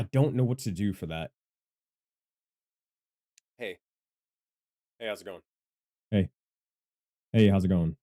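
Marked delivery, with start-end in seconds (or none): 6.1-8.0 louder mic-test greeting; punchy, loud hello to test levels; raised voice. Shown as a demonstration. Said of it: "Whenever I raise my voice".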